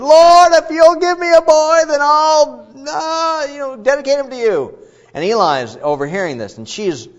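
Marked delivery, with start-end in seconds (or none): 0.0-4.8 high, loud, pleading woman's voice; Hannah pleading. Retold or imitated. Hannah vowing to the Lord for a son, high pleading cry. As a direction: cry out a desperate vow to God. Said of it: "and she vowed and said".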